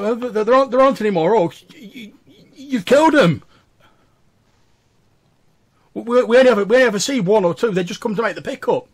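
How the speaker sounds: high, loud frightened protest; panicked stammering. Acted. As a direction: splutter in rising panic. Scared captive protests to interrogators.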